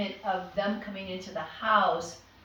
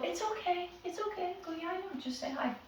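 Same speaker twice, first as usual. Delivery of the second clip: higher, much softer, fluttery reassurance; nervous guest; 8 semitones higher, 8 dB quieter than usual. Retold or imitated. Guest afraid of the dog, high soft reassurance. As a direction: mimic a timid guest, high and hushed. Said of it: "they're gonna go".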